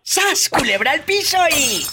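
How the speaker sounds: high excited exclamation; excited catchphrase. Real, not acted. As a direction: call it out high.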